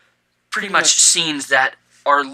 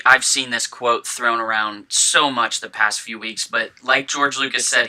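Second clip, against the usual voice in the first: normal talking voice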